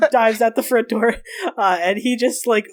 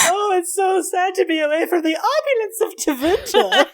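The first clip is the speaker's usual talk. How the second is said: high, mock-tearful wail; high, hysterical mock sorrow; mocking through laughter; 10 semitones higher than usual. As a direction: tease in a hammed-up lament, near breaking up. Dorian mocks Elian while laughing hysterically.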